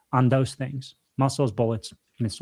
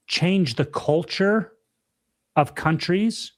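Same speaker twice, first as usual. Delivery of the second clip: usual speaking voice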